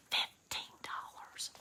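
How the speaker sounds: slow hushed whisper of the price. Real, not acted. Whispering the yard sale price to viewers. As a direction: drop to a secret whisper, drawn out. Revealing the bargain price as secret.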